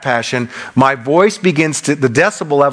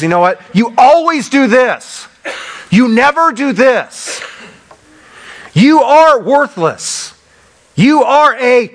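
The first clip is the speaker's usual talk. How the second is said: higher, louder and slow, hurling each accusation; angry outburst; 8 semitones higher, 3 dB louder than usual. Acted. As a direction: spit out the blame like a furious partner. Quoting an angry person's outburst.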